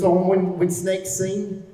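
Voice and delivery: lightly put-on Australian accent, slow on the last words; a playful try at an Australian accent. Imitating the Australian guitarist, light Australian accent. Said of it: "my attempt at an Australian accent".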